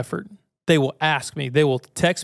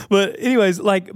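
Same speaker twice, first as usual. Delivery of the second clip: usual speaking voice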